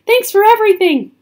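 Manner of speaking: fast, high and loud; called-out thanks. A narrator's reading. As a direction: shout thanks in a hurry. Jack calls out while fleeing.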